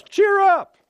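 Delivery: very high, squealed rallying cry; exuberant exhortation. Acted. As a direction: pipe it up high to rouse the room. Acts talking to oneself in mirror.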